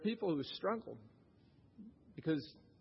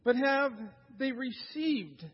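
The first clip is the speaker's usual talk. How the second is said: raised, urgent preacher voice; preaching; 7 semitones higher, 4 dB louder than usual. Real, not acted. Pastor urging churchgoers to examine themselves, earnest preaching. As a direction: press the point home to the congregation.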